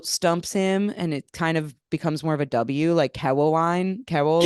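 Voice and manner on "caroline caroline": put-on "Caroline" said "Cawoline" in a Lebanese accent; "Caroline", the r going to w, Lebanese dad style; imitating the Lebanese-accent "Cawoline". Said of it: "something with his Lebanese accent".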